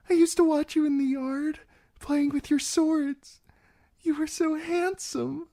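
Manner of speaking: put-on high, girlish and anxious; a frightened young bride trying to sound brave; nervous young bride pleading her case.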